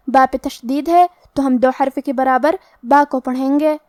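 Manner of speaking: ordinary delivery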